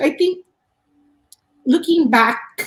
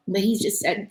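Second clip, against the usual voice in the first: normal talking voice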